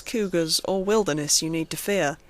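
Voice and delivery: plain reading voice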